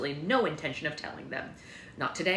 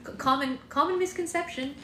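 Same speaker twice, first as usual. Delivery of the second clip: much higher, fussy prince; prissy correction; 10 semitones higher than usual. Acted. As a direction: correct them with prim fluster.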